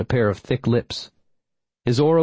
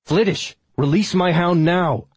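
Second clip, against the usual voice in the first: sharp, raised order; snapping; 8 semitones higher, 3 dB louder than usual. A narrator's reading. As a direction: snap the command high and hard. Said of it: "I snapped".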